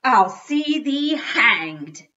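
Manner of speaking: loud, slow, drawn-out curse; howled threat. A narrator's reading. Dad cursing the toy's destroyer, slow howling threat. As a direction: declaim the threat in high outrage. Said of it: "my dad howled".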